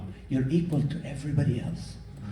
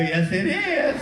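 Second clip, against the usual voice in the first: defiant shout; 8 semitones higher, slower than usual. Retold or imitated. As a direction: fire back a cheeky lie. Insisting red peppers are yellow.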